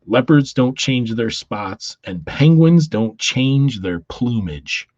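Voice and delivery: slow, loud lecturing Batman; hammed-up solemn lesson, slowly; Batman preaching.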